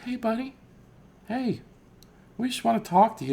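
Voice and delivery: way up high, coaxing a prisoner; mock-friendly squeaky wheedle; sugary high voice coaxing the captive to talk; high sweet-talking voice. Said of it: "the next one was like".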